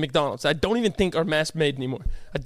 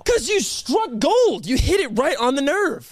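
exasperated; 7 semitones higher, slower than usual. Real, not acted. Complaining of hit nerve.

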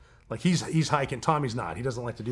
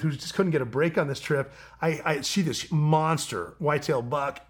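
ordinary delivery

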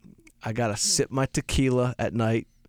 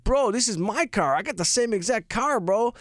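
put-on young salesman; 9 semitones higher than usual. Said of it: "a fucking nineteen-year-old".

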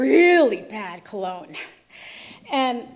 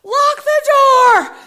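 high, loud, stretched-out scream; blaring command from inside the head; yelling inner voice; 11 semitones higher, 15 dB louder, much slower than usual. Retold or imitated. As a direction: holler an urgent order slowly. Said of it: "his voice says".

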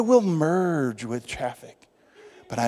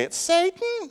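much higher put-on church lady squeal; mock church lady voice; slower than usual. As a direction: gasp it like a startled church lady. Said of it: "how church ladies just say".

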